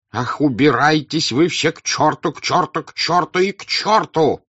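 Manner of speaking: loud, high furious outburst; old count shouting. Acted. The old count yelling at Berg and everyone, furious. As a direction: blow up, shouting them all away. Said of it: "закричал старый граф".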